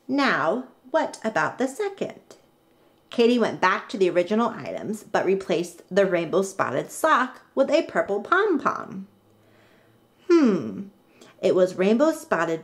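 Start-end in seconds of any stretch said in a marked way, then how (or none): none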